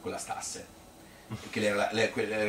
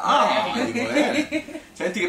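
laughing; 13 semitones higher, 5 dB louder, much slower than usual. Real, not acted. Laughing at his train excuse.

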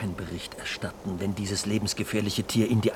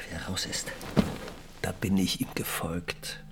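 quiet whispered admission; 5 dB quieter than usual. Acted. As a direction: confess it softly. Night talk, hushed.

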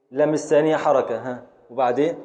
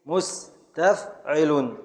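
slow scansion; 3 dB quieter, much slower than usual. Shown as a demonstration. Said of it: "دالله مس".

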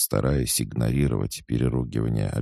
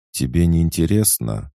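put-on higher, displeased girl asking; 6 semitones higher, 4 dB louder than usual. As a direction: sound put out and pouty. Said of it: "раздался недовольный голос Эми".

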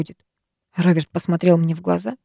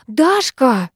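higher, mildly hissed name; urgent hiss; 5 semitones higher than usual. A narrator's reading. Friend stopping her slipping out, hissed. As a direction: call her back with mild urgency. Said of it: "зашипела Викуля".

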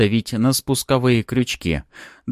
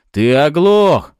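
high, slow, drawn-out shout; loud exclamation; 7 semitones higher, 9 dB louder, much slower than usual. A narrator's reading. Cop yelling at disobedient hero, loud exclamation. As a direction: holler it at someone not listening. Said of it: "воскликнул Пузан".

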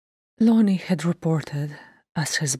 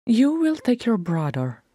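higher-pitched, firm command; firm and insistent, brooking no refusal; firm order; 7 semitones higher than usual. A narrator's reading. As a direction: lay it down as a mother's order. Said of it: "her voice just as firm as his".

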